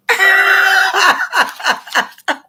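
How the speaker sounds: big shrieking burst of laughter; loud amused cackle; loud high laugh. Real, not acted. Laughing at dated Southern Belle.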